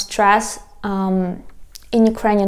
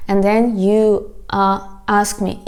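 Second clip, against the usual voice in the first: usual speaking voice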